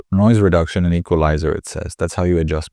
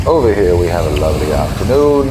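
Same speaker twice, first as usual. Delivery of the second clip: too loud; 4 dB louder than usual. Shown as a demonstration. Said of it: "Way too loud".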